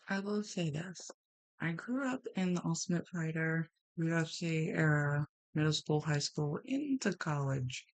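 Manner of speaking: normal talking voice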